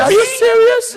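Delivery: loud, very high mock protest; hammed-up incredulous squeal; high-voiced disbelief. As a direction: shoot the pitch up and play it stunned. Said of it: "when your voice gets high".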